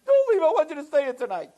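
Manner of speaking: put-on high, wailing child voice; pleading little boy. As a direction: imitate a small boy begging desperately. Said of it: "the little boy cries himself to sleep".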